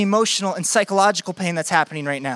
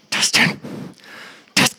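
whispered urgently to a friend on stage; 3 dB quieter than usual. Retold or imitated. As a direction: hiss his name so the congregation can't hear. Urgent hiss at burning friend.

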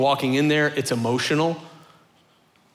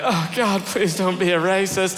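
lightly voiced anxious plea, a little higher; worried listeners; 3 semitones higher than usual. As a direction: imitate a cringing audience member's plea. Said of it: "I know some of you are like".

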